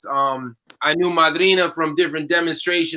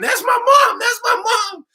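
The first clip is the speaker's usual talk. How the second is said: excited yell. Retold or imitated. Student yelling out in the middle of class, high excited shouts. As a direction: shout it across a dark classroom. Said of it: "And this young woman".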